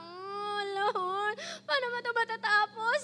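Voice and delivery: high, drawn-out mock whine; whining plea. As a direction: whimper it as an exasperated, hammed-up plea. Acted weary complaint to God.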